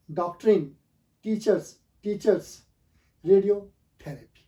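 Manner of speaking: each word said alone, slow and clear; pronunciation drill. Shown as a demonstration. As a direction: read the list like a teacher drilling students. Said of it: "मैं आपको इनको repeat कर देता हूँ". (शब्दों को एक-एक करके धीरे और साफ़ दोहराना)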